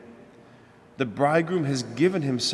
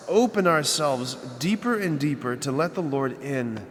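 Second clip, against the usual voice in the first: ordinary talk, nothing marked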